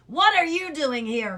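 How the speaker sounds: growled. A narrator's reading. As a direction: growl at the boy like a guard dog. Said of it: "growled the watchdog".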